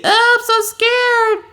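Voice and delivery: very high squeaky fake fright; hammed-up, drawn-out terrified squeal; mock scared. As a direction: play scared in a slow, loud falsetto. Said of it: "you say".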